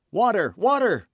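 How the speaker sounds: very high, loud shout. A narrator's reading. D'Artagnan shouting for water, loud cry. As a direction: yell for water. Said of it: "cried D'Artagnan".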